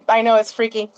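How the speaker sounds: ordinary talk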